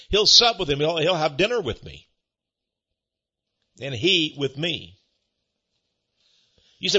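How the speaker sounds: ordinary talk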